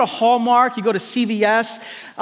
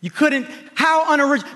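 mock sniffy scorn; 6 semitones higher, faster than usual. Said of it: "she doesn't say".

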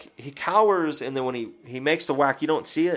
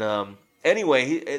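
ordinary talk, nothing marked

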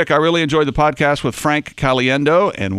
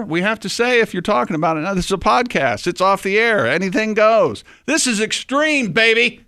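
excited; 9 semitones higher than usual. Real, not acted. Hyping up the podcast.

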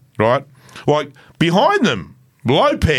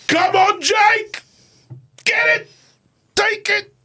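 high cheering shouts; Cheryl screaming; much slower than usual. Retold or imitated. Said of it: "could hear Cheryl screaming".

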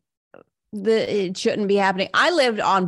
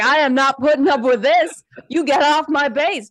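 high, loud indignant yell; shouting at trespasser; 7 semitones higher, 4 dB louder than usual. Retold or imitated. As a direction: tell the intruder off loudly. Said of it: "her husband, was like".

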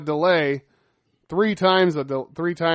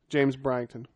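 ordinary talk

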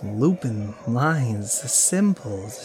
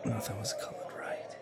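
soft, fast unvoiced murmur; hushed whisper; 7 dB quieter than usual. Dazed man whispers his memory to himself. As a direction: whisper it to yourself.

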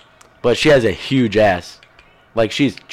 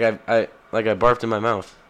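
normal talking voice

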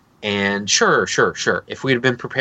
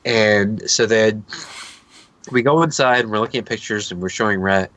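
ordinary delivery

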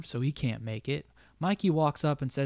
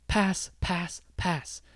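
higher, insistent coach chant; mock coach; 4 semitones higher, slower than usual. Coach instructing team at halftime, a mock insistent chant. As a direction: hammer the word pass like a coach. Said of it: "He's like".

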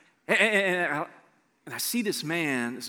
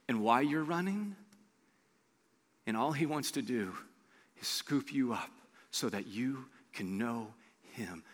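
quiet, lower, heartfelt close; tender appeal; 6 semitones lower, 10 dB quieter than usual. Acted. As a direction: comfort the lonely listener gently.

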